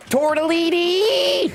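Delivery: high, long sing-song call; stretched high excited call; calling the pet. Real, not acted. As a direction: call the pet across the room.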